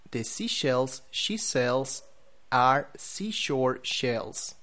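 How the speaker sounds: slow, carefully separated tongue twister; slow practice pace with pauses. Shown as a demonstration. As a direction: recite it slowly, word by word, for learners. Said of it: "practice it slowly".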